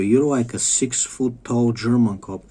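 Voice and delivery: ordinary talk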